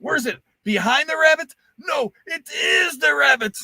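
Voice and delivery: high, loud mock shouting; quoting knights. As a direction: bellow it like a panicked knight. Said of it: "They're like".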